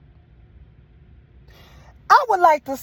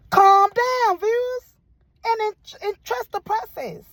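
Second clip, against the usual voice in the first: preaching; 6 semitones higher than usual. Acted. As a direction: testify it like a Sunday sermon. Preaching at viewers about church.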